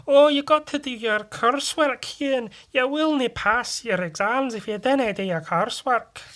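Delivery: high, scolding Scottish woman's voice; loud, high-pitched Scottish brogue; Scottish teacher. Retold or imitated. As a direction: nag like a hammed-up Scottish schoolteacher. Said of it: "English teacher who was Scottish".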